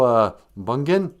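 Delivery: ordinary talk, nothing marked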